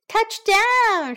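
high, loud cheer; excited shout. Acted. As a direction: yell the touchdown. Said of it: "shouted Danny".

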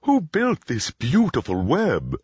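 high, hooting owl character voice; storybook owl. A narrator's reading. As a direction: ask as a wondering owl. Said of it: "asked the owl".

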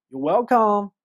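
loud, pointed reminder of missing thanks; pointed you're welcome. Shown as a demonstration. Helper to someone who never said thanks, pointedly reproachful. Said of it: "你怎么不说谢谢啊".

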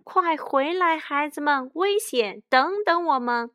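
high, urgent warning shout; high-pitched storybook holler; loud calling out. A narrator's reading. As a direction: call the children back from danger. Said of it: "只好大声喊道".

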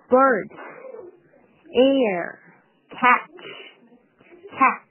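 "bird", "air", "catch" each hit singly and slowly; stressed words. Shown as a demonstration. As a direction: punch out "bird", "air", "catch" with pauses between. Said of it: "那这面的重读呢有". (逐个慢速重读bird、air、catch)

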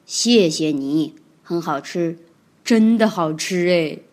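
低沉缓慢，带点感动; 真心地轻声道谢; 感动地道谢. Acted. 霸王龙感动. (霸王龙低声感动地道谢)

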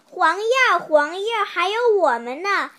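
loud, high call of little bugs; a child's bright calling-out voice. Acted. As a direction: shout to catch the leaf's attention. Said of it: "它们一起喊".

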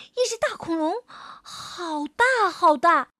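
high, slow, wide-eyed awe; awed and scared. Acted. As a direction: stretch out how huge it is. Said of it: "小恐龙惊慌地抬起头". (又怕又惊地拖长说)

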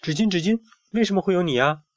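put-on high child's calling voice; child's question. A narrator's reading. Child character calls to the tissue.

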